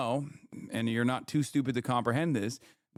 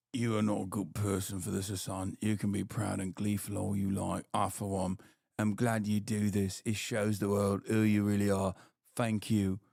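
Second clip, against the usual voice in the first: lower, mock-solemn scolding read; mock chatter voice; 7 semitones lower, 3 dB quieter than usual. Streamer reading a chat critic's message aloud, mock-solemn. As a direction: drop the voice low to read the hater's message.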